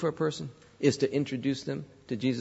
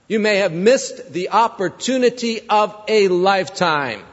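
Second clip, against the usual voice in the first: louder, driving sermon voice; full-force preaching; 4 semitones higher, 5 dB louder than usual. Acted. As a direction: press the point home to the congregation. Preaching to the congregation.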